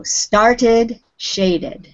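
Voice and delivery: started and shaded, each with the extra -ed syllable; started, shaded, two syllables each. Shown as a demonstration. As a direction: sound the extra -ed. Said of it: "sound like an extra syllable".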